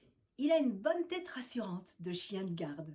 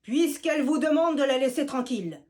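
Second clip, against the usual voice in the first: big voice; 3 semitones higher, 10 dB louder than usual. Acted. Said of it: "fait soudain une grosse voix".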